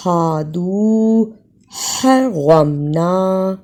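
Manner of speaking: slow ہادو light h, حرمنا throat h; two h sounds. Shown as a demonstration. Teacher shows both h sounds to students, slowly. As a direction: split the light h and the rasped throat h. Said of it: "پہلی ہا کو ہائی کی طرح".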